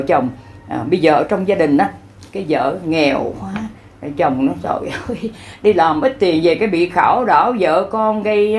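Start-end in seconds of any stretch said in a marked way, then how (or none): none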